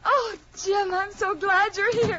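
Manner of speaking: high, loud, drawn-out welcome; overjoyed relief. Acted. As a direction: let the relief pour out. Relieved wife greets late husband.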